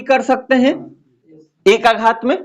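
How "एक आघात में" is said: usual speaking voice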